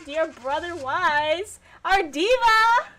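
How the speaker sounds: slow, drawn-out, rising gush ending high and loud. Real, not acted. Streamer greeting her favourite character, gushing and drawn out. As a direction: swoon over your favourite.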